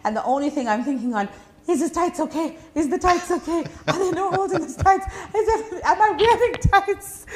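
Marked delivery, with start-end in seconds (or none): 1.7-7.2 high, giggly panic over her tights, much higher and louder; panicked laughing thoughts. Retold or imitated. MC retelling her fall to the interviewer, high laughing panic. Retold panic after falling on stage.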